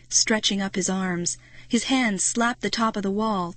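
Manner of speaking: ordinary delivery, nothing marked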